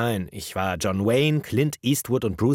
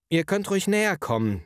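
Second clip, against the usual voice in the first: calling out; 10 semitones higher than usual. Acted. Painter invites kindergarten children to come nearer, calling out. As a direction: invite them closer in a raised pitch. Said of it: "rief ich".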